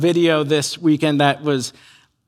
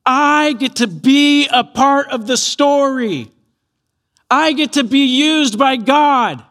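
high, slow, emphatic wonder; imagine Mary marvelling at her part; joyful amazement; 6 semitones higher, 3 dB louder, slower than usual. Retold or imitated. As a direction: savour each word with elated gratitude. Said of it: "so grateful and full of joy".

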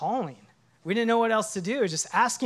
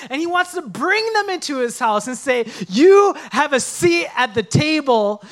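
loud, higher, impassioned pastor; preaching at full force; 6 semitones higher, 7 dB louder than usual. Acted. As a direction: preach it to the congregation with fire.

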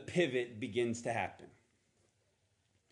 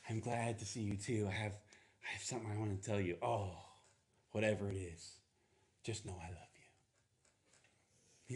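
quiet and gentle, fading to a near hush; breaking it to him tenderly; soft bride; 3 semitones lower, 6 dB quieter than usual. Retold or imitated. Mary softly telling Joseph her news, quiet and tender. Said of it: "And then she goes".

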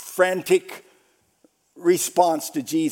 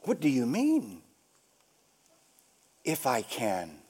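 low, soft questioning after a long pause; quiet challenge; 7 semitones lower, 9 dB quieter than usual. Retold or imitated. Jesus answering the desperate father, quiet challenge. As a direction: answer softly, lower and unhurried.

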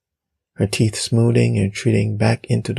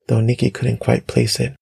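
usual speaking voice

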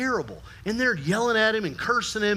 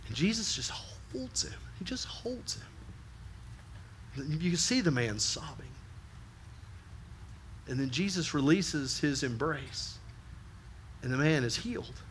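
hushed and tender; 2 semitones lower, 9 dB quieter than usual. Acted. Tender climax of the story.

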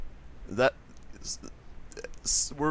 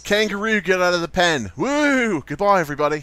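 mock Australian accent; 7 semitones higher, 7 dB louder than usual. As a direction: holler it in a hammed-up Australian accent. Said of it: "You kinda sound Australian there".

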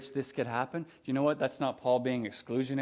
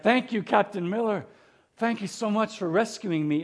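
higher, earnest-sounding mock thanks; grateful gush; 6 semitones higher than usual. Ryan thanks Captain Miller, higher mock gratitude. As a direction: gush thanks at your rescuer. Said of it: "We're like".

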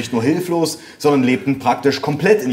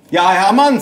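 higher, louder telephone greeting; mother on phone; 7 semitones higher, 5 dB louder than usual. A narrator's reading. Mother answering the ringing phone, high and loud. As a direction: pick up and announce yourself brightly.

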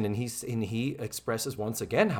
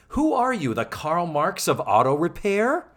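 mock Blair retort; 5 semitones higher, 4 dB louder than usual. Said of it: "And Blair says".

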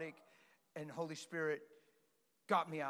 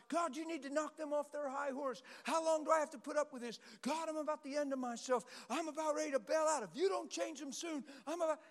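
mock angry prayer; 9 semitones higher, 3 dB louder than usual. Angry spouse praying, imitated.

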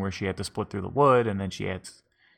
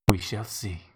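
mock mystical; 2 semitones higher, 5 dB quieter, slower than usual. Fortune teller old lady speaks.

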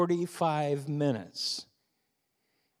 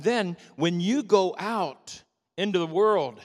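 fervent preaching; 4 semitones higher than usual. Acted. Pastor exhorting believers to show passion, fervent. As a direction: preach it to the congregation with burning urgency.

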